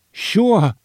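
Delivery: high, eager quick sure; fake eager. A narrator's reading. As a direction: feign eagerness to hide defeat. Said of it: "tried to seem eager".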